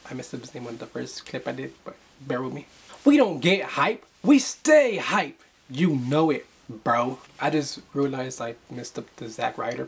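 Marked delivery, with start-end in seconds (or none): 3.0-7.2 hyped catchphrase. Acted. Shouting his hype catchphrase.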